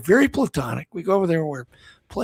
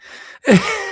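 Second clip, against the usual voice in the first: high laugh; 6 dB louder than usual. Real, not acted. Laughing at own story setup.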